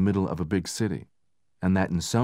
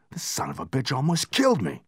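angry; 7 semitones higher than usual. Acted. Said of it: "He felt angry".

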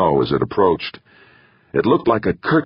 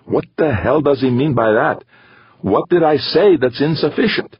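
high, exasperated outburst; frustrated; 8 semitones higher than usual. A narrator's reading. Gaeta wanting to pound the roof, frustrated. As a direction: fume with the pitch pushed up. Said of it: "felt like pounding both fists".

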